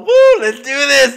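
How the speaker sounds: loud, high hyped-up cheer; mock hype. Friend retelling his own hype before sleeping. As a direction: pump yourself up. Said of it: "I was like".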